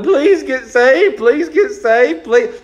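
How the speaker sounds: slow, high whiny begging; hammed-up tearful pleading; mock pleading God. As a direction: beg like a weeping parent, mocking it. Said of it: "crying and bawling and going".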